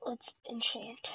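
slow hushed whisper; slow secretive whisper to himself. Real, not acted. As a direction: breathe it out, barely voiced. Quietly working while hiding.

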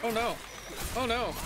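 rising alarmed cries; panicked oh no. Real, not acted. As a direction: yelp it in sudden panic. Near miss in game.

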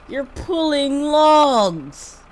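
high, loud, stretched-out shout; drawn-out yell. Real, not acted. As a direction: holler it out, dragging the words long. Yells while struggling with slow log haul.

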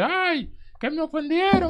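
high, whiny mock of someone offended; mock whining. Mocking a friend who feels offended, high whiny crybaby voice. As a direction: mimic a touchy crybaby complaining. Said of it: "Si usted se sintió de que pisoteado".

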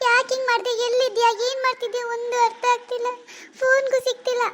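put-on high, anxious young woman calling for her missing sister; frantic elder sister. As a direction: panic rising as she searches the house. Worried elder sister searching. (ಆತಂಕದಿಂದ ತಂಗಿಯನ್ನು ಹುಡುಕುವ ಅಕ್ಕನ ಧ್ವನಿ)

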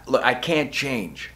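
ordinary talk

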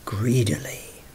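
greedily whispered with relish. A narrator's reading. Narrator hushing the word greedily.

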